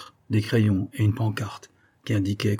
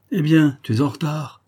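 mild reproach, higher and louder; reproachful; 6 semitones higher, 5 dB louder than usual. A narrator's reading. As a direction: chide him lightly for turning up late. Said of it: "dit-il d'un ton de reproche".

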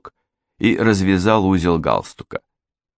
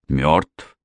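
mildly incredulous echo with rising tune; disbelieving question; 3 semitones lower than usual. A narrator's reading. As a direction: ask back as if not believing your ears. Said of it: "переспросил ... словно не веря своим ушам". (недоверчиво переспросить)

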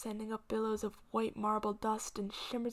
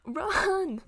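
higher, louder urgent shout; shouted warning; 8 semitones higher, 9 dB louder than usual. Acted. Grandmother shouting at Green to flee. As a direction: yell at him to run.

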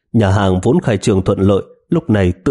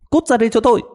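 high sharp yelled order; barked command; much faster than usual. Acted. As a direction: yell at him to come out. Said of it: "quát lên".

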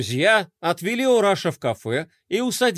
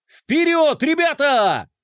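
high, loud battle cry; rallying shout; 8 semitones higher, 4 dB louder, slower than usual. A narrator's reading. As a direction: call the charge.

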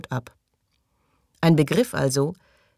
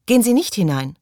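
higher, louder warning; urgent call; 6 semitones higher, 5 dB louder than usual. A narrator's reading. Maggie warns Morley off the door, urgent call. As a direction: stop him with your voice. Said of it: "rief Maggie hinter ihm".

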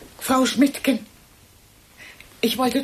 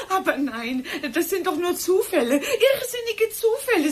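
nervous laughter tipping into tears, much higher; hysterical laugh; 9 semitones higher than usual. Acted. Accused woman laughing off the hatred charge, near hysterical. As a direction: laugh it off as it cracks into crying, rising higher.

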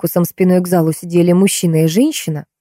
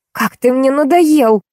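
high, slow, fed-up outburst; exasperated exclamation; 8 semitones higher, slower than usual. A narrator's reading. Woman sick of man's sarcasm, exasperated. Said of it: "повторила его собеседница".

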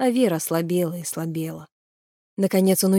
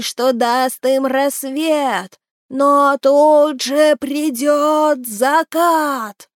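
high, very slow wailing chant, rising to a shout; keen the prophecy like a mad creature, swelling at the end; thin wailing voice; 6 semitones higher, 5 dB louder, much slower than usual. Acted. Rocking creature wails its prophecy at Naal, thin and howling. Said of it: "заговорило тоненьким, завывающим голосом".

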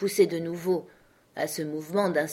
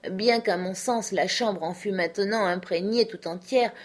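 ordinary delivery, nothing marked

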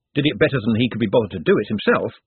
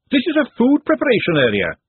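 stern scolding; 13 semitones higher, 5 dB louder than usual. Acted. Glenda scolding the boys.

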